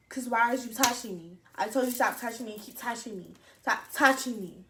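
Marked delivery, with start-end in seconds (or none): none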